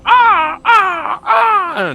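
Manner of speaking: loud, very high crow cawing; crow caws. Pretend. Game master imitating gathering crows, loud high caws.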